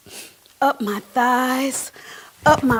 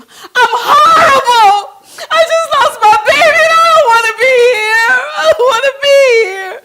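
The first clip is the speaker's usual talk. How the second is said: crying shout, much higher and louder; scream; 12 semitones higher, 15 dB louder than usual. Pretend. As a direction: scream it out in despair. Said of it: "I wanted to scream".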